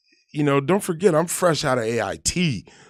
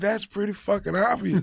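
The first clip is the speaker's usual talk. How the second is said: laughing sergeant; 9 semitones higher than usual. Retold or imitated. Sergeant mocks private, laughing.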